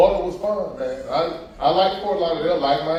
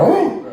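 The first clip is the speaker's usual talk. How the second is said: smoky dog bark; 10 semitones higher, 6 dB louder than usual. Retold or imitated. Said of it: "He'd be like".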